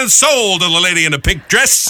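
high, ringing auctioneer's sale call. Acted. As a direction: call the winning bid to the room. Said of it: "The auctioneer".

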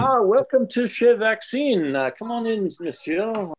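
a lightly faked French accent, snooty maître d'; hammed-up French host, welcoming; fake French accent. Maître d' welcomes customer with mild fake French accent. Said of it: "my fake French accent for you".

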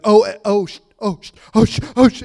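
hammed-up stammering half-swear; imitating a man hit by the Spirit; mock cussing. Retelling a man overcome in church, stammering half-swears. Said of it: "he's cussing right in the middle of church".

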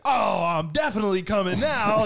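loud, high, hammed-up excitement; mock eager shout. Host imitates friend on the phone agreeing to come, mock eager shout. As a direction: imitate a friend suddenly raring to go.

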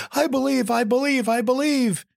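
high, frantic repeated I believe; eager believer. Acted. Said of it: "And the man said".

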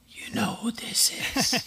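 hoarse whispered rasp, high. Retold or imitated. Caller rasping a whisper to wake him at night. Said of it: "I hear somebody go".

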